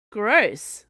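loud, high disgusted cry. Acted. Camilla reacting to candy gore, disgusted. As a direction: spit it out in disgust.

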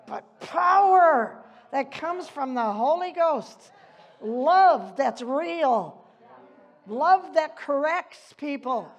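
loud, emphatic preaching of the verse; preacher thunders Timothy's verse at the church; fiery preaching. Acted. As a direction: proclaim it to the congregation with force. Preaching scripture at full force.

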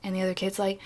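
ordinary delivery

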